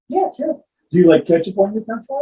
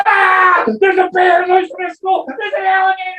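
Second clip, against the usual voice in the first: high frantic yelling of the alarm; panicked shout; 11 semitones higher than usual. Acted. Teacher screaming to the class about a bear.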